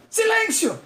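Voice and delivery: very high shouted command for silence; imitated yell. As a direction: yell it like a harsh examiner cutting her off. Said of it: "ele gritou".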